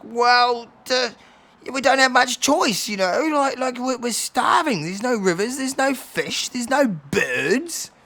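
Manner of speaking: high, loud, plaintive elder; Bobby. Acted. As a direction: plead starvation, stretching the last words. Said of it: "Bobby's like".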